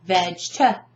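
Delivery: "Vegeta" as vej-tuh, two separate beats; "Vegeta" chopped, with no middle E; two isolated syllables. Shown as a demonstration. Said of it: "I break it down completely".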